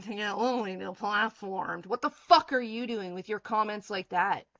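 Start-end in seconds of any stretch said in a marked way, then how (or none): none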